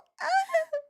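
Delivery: very high fake whimper; hammed-up high sob; mock sob. Commentator imitates her crying, fake high-pitched sob. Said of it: "She's like".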